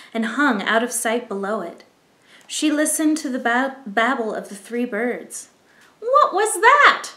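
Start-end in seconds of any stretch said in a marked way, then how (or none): 6.0-7.1 high, loud and slow startled chirp; startled baby bird cry. Acted. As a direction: cry it out like a spooked chick. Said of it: "cried Flap".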